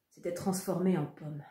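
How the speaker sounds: plain reading voice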